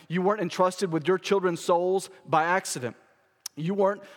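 usual speaking voice

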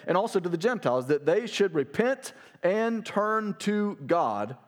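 fervent preacher reading Paul's words; preaching at full force. Acted. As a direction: proclaim it to the king. Preacher reads Paul's defense.

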